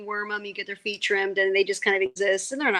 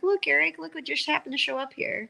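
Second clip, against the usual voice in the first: lightly voiced mom, higher pitch; mom feigning innocence; 6 semitones higher than usual. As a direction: pretend innocent surprise, a touch sing-song. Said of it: "She'd bring home a cat and be like".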